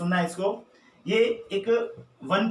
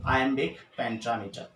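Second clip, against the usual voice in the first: slow and careful; 4 semitones lower, 3 dB quieter, much slower than usual. Shown as a demonstration. Teacher slowly spelling out the term for students. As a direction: say iambic pentameter very slowly, every syllable clear.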